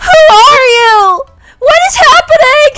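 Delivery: high, loud comic shriek; over-the-top high-pitched yell; mock freak-out. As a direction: shriek it in exaggerated bewilderment. Imagined outburst at a chatty stranger.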